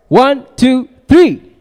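loud, high count to three; rallying count-in. Real, not acted. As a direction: count the crowd in loudly. Counting the audience in.

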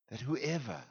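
normal talking voice

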